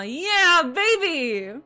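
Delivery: ordinary delivery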